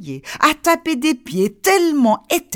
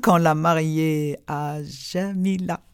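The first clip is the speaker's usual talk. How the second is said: slow punchline; 7 semitones lower, 4 dB quieter, slower than usual. A narrator's reading. Story punchline slowed.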